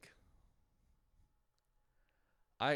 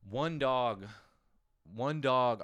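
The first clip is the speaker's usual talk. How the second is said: louder, ringing out the count; punchy loud announcement; 4 semitones higher, 9 dB louder, much slower than usual. Real, not acted. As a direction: announce it like a headline. Punchline of dog joke.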